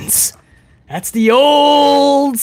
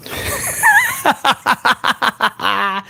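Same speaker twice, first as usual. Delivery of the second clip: high start, descending cackle; villainous mocking laughter; 5 semitones higher, 4 dB quieter than usual. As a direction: cackle in scornful staccato bursts.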